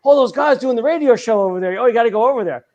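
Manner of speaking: high-pitched eager tip; mock excited festivalgoer. Festivalgoer sending others to the radio show, excited.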